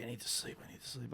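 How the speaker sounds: hushed retold crackhead murmur; imitating sleepy mumbling. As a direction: drop to almost nothing, as if dozing off. Crackhead begs to be left sleeping.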